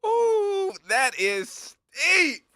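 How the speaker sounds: high, loud, slow mock wince; surprised ooh. Real, not acted. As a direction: react like the price stings. Reacting to big fine.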